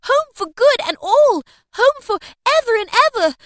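put-on very high, loud little-girl joy; brimful of glee. A narrator's reading. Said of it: "brimful of glee".

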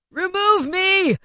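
high, loud, slow anguished cry; desperate shout. A narrator's reading. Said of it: "Scrooge exclaimed".

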